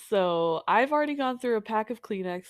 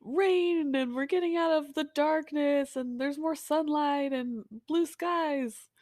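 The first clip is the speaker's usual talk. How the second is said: high bright eager chirp; cheerful and hopeful; 9 semitones higher, 4 dB louder than usual. Retold or imitated. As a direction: sound giddy about spring. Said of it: "we're like".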